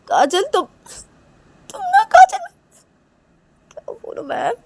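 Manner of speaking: high, halting, choked-up plea; acted tearful distress. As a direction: break off, overcome and lost for words. Acted distress at friend's cut hands.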